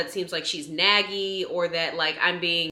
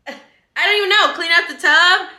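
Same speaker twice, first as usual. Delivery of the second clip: much higher and louder, incredulous; outraged disbelief; 9 semitones higher, 10 dB louder than usual. Real, not acted. Vlogger ranting to viewers about the plumber's pot, pitched way up and loud. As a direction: let the outrage spill over, pitched way up.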